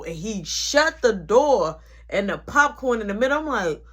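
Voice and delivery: usual speaking voice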